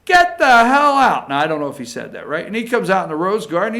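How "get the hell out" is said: much higher, louder mock Trump outburst; hammed-up Trump yelling get out; Donald Trump, angry. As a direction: blow up like an outraged Trump, shouting high. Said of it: "Trump said".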